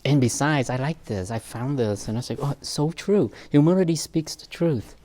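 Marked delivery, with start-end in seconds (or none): 2.4-4.9 louder, higher quote of being struck; retold delight. Speaker quoting own reaction to a saying, retold excitement. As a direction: lift and push it like a happy discovery.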